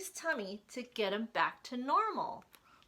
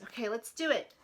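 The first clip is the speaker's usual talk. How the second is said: ordinary delivery, nothing marked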